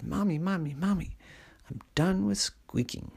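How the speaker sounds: put-on a kid bursting with news; eager calling. Helen runs home calling mommy.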